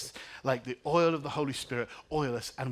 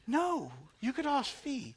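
higher, slow tearful answer; crying reply; 11 semitones higher, slower than usual. Real, not acted. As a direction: answer through tears. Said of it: "And I'm crying like".

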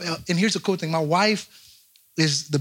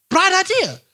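very high, mock-inspired exclamation; sudden bright idea; 14 semitones higher, 3 dB louder than usual. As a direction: pitch it way up like an epiphany. Acting out a foolish inspiration.

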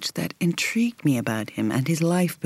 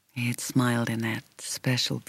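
usual speaking voice

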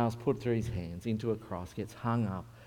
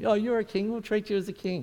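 lightly mocking, pitched up; mock homage in a higher voice; 7 semitones higher than usual. Soldiers jeering at the prisoner king, mocking. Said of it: "So they're mocking him".

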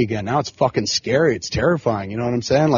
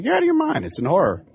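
incredulous; 7 semitones higher than usual. Retold or imitated. Imagined retort to a customer.

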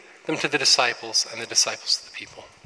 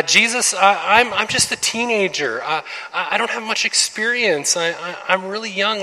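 put-on higher, halting teen voice; hesitant teenager; 7 semitones higher than usual. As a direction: stammer out excuses as a teen.

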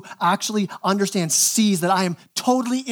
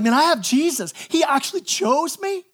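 acted joyful amazement; 7 semitones higher than usual. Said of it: "you're blown away by it".

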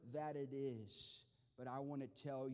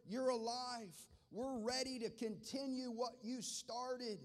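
higher, louder pulpit fervor; rising pulpit passion; impassioned preaching; 9 semitones higher, 6 dB louder than usual. Acted. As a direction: preach it hard to the congregation. Preacher building to full force.

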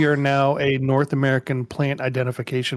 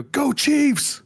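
excited cheer; 13 semitones higher than usual. Retold or imitated. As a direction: shout it like a hyped fan. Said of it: "They would just flip out and go".

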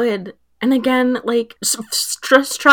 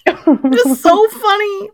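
real laughter, slow and high; laughing; 11 semitones higher, 5 dB louder, slower than usual. Real, not acted. Friend reacts to the ruler joke, laughing. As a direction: say it while cracking up.

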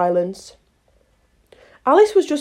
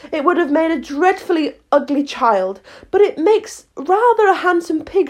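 put-on higher-pitched thoughtful girl; Alice, musing; 7 semitones higher than usual. A narrator's reading. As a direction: muse to yourself as young Alice. Alice's thought voiced within narration.

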